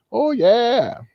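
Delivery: high, wistful sigh of an elder; slow, high fond reminiscing; wistful old-timer. Acted. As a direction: savour the memory like an old man. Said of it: "they might have said some things like".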